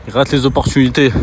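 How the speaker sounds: loud, emphatic outburst; loud exclamation. Real, not acted. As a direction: call it out loud. Calling out about missed chances.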